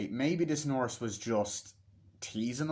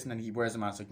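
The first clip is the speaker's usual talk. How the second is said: usual speaking voice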